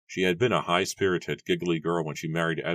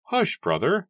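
high, raised elder's voice; instructing; 5 dB louder, slower than usual. A narrator's reading. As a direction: hush a brother in a lifted voice. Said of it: "Matthew instructed".

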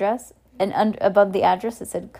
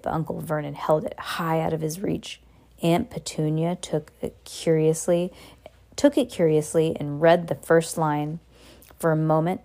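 plain reading voice

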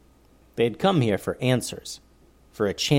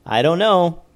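joking; 6 semitones higher, 6 dB louder than usual. A narrator's reading. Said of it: "joked Eleanor".

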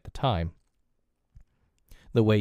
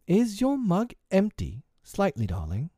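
playing mother; 10 semitones higher than usual. A narrator's reading. Wendy playing mother at table.

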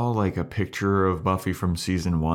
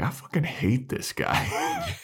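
grumbling Clem; 4 dB quieter, slower than usual. Retold or imitated. Clem voiced in a retold scene.

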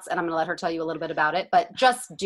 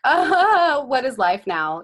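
loud, high despairing sigh; exasperated; 6 semitones higher, 5 dB louder, much slower than usual. Retold or imitated. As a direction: wail it in mock despair. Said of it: "insane like the rest of us".

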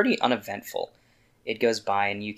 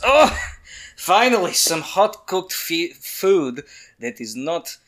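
gruff dwarf voice; 2 semitones higher, slower than usual. Acted. DM voices Bronzebeard.

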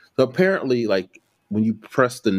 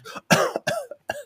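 high giggle; 4 dB quieter than usual. Retold or imitated. Laughing at his state.

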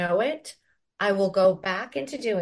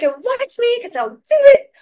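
high, loud defiant cry; a stubborn shout breaking into a cry; 13 dB louder than usual. Retold or imitated. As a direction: hurl it back, voice cracking high. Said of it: "I'm like".

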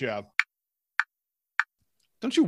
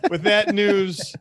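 slow, drawn-out and louder; slow announcer; 5 semitones higher, 4 dB louder, much slower than usual. Real, not acted. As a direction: stretch it out like a sign-off. Sign-off announcement.

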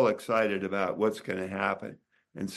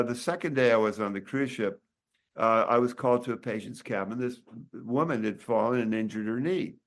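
ordinary talk, nothing marked

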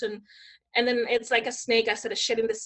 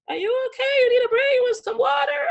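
imitated worried photographer, high and loud; high-pitched fussing over someone upset; concerned photographer; 12 semitones higher, 6 dB louder than usual. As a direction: mimic an alarmed, solicitous onlooker. Retold photographer fussing over her crying.

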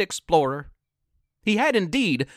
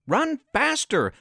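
higher, urgent plea to run faster; imploring; 8 semitones higher than usual. Acted. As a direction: urge her on, begging. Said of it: "Donnie implored".

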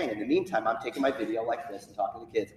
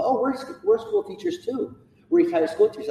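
retired teacher lady; 14 semitones higher, 8 dB louder than usual. Retold or imitated. Retired teacher woman voiced.

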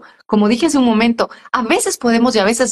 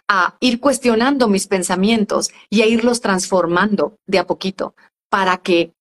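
ordinary talk

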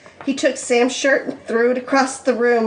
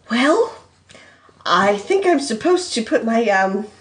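halting, awkward explanation; unsure, searching for each phrase; hesitant. Acted. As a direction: trail into fillers and long pauses. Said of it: "Sam said hesitantly".

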